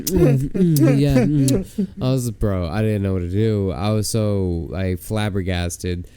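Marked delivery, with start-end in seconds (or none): 0.0-1.2 loud, high-pitched mock smooching moans; hammed-up "mm"s of someone kissing greedily. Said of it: "She was like".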